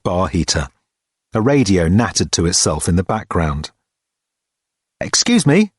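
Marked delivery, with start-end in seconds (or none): 5.0-5.7 high-pitched, polite interruption; hesitant Richard. A narrator's reading.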